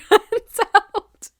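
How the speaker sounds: high, loud laughing finish; breaking into laughter. Retold or imitated. Character promising the audience they'll be official, laughing. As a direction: let a laugh burst through the last words. Laughing through the mock announcement.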